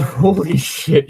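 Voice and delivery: high, slow laughing disbelief; amazed laugh at a friend's line; laughing exclamation. Real, not acted. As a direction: laugh it out in delight.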